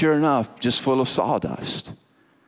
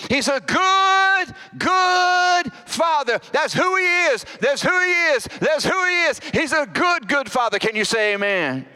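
full-force preaching. Acted. Preacher to congregation, soaring full-force preaching. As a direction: lift the congregation with a soaring proclamation.

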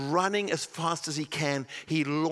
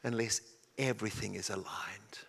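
soft breathy insistence on everything; whispered emphasis on everything; 8 semitones lower, 10 dB quieter than usual. Acted. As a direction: hush it to make it land. Preacher repeats whispered point.

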